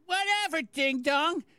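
high-pitched dismissive mimicry; high, put-on mocking read; Eric. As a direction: imitate him in a high, taunting voice. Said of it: "And he goes".

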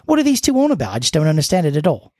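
put-on British listener complaining; British accent. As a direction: play a politely cross caller in British accent. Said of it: "That was British".

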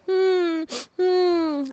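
little whimpering sobs; crying. A narrator's reading. The little flea weeping for Piojito, with whimpering sobs. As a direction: hum out two small sobbing cries. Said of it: "la pulguita se puso a llorar".

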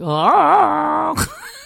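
squealing laugh; real high helpless laugh; high crying laugh. Real, not acted. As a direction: break into teary laughter. Laughing at French word.

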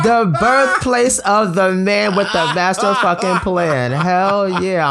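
high, excited boasting; hyped up. Real, not acted. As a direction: celebrate it like a hype man. Hyped celebration of the news.

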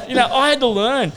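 loud high laughing. Real, not acted. Laughs after tease.

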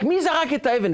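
mock yell, pitched well up without real volume; retold shout. Furious driver demands who threw the stone, mock shouting. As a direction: call out an acted, high-pitched demand. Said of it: "והוא יוצא מהדלת וצועק".

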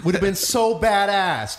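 normal talking voice

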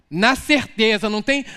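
normal talking voice

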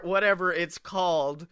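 ordinary delivery

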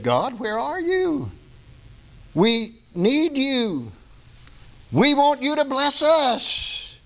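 high, slow pleading to God; pleading cry. Acted. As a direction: beg slowly, voice lifted high. America begging God after disaster.

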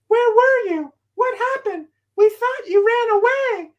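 high, loud worried mother; frantic mother. Retold or imitated. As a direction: shriek at the runaway son. Worried mother scolding son.

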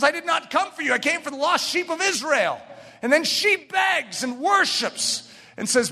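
high, insistent pulpit delivery; impassioned preaching. Real, not acted. Preacher telling of the pleading Canaanite woman, impassioned preaching.

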